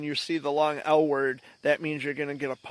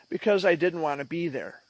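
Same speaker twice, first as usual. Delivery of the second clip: ordinary delivery, nothing marked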